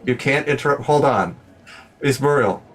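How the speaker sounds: ordinary delivery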